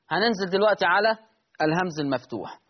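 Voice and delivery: normal talking voice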